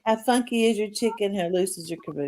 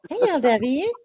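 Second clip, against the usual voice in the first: high, teasing creepy clown; 9 semitones higher than usual. Pretend. S1 asks L W for nerds in a creepy clown voice. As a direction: coax like a clown offering candy. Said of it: "Do I sound like a creepy clown yet".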